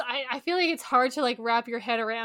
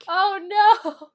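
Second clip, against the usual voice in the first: a louder, laughing-in-horror oh no; loud dismayed cry; 7 semitones higher, 8 dB louder than usual. Real, not acted. Friend cries out on hearing about the Scottish accent. Reacting to horrific Scot accent.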